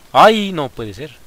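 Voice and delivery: slow loud dismayed cry. Real, not acted. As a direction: drag it out in disbelief. Disaster in battle.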